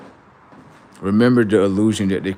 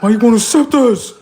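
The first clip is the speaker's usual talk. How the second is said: high, loud, incredulous voice; mock indignant spirit; 14 semitones higher, 7 dB louder than usual. Spirits demanding to be accepted, high incredulous squeal. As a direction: squeal it like they can't believe it. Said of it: "they come up to him like".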